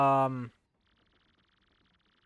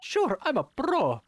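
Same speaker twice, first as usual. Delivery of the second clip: high, slow cartoon plumber voice; a half-slipped Mario impression, pitched up; 9 semitones higher, 4 dB louder, slower than usual. Player answering the kid in a Mario voice. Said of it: "still stuck halfway in the Mario voice".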